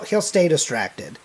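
ordinary talk

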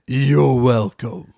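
low, slow smug drawl. Retold or imitated. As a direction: gloat like a trickster.

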